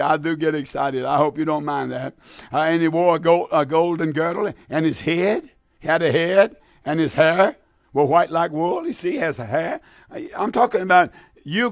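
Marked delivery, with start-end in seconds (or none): 2.6-8.9 reading the verse out in a sermon; solemn preacher reading; scripture reading. Acted. Radio preacher reads Revelation, solemn reading.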